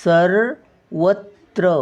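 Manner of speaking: सर्वत्र drawn out slowly, each syllable clear. Shown as a demonstration. Teacher showing students how to say it, slow and careful. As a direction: spell out सर्वत्र slowly for the class. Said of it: "रचा उच्चार येतो".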